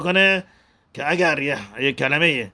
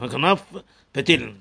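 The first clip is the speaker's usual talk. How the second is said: کناف with a rise, brief pause, then پتیل; short gap between کناف and پتیل; words kept apart. Shown as a demonstration. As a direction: finish کناف fully before starting پتیل. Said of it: "به هم میچسبن".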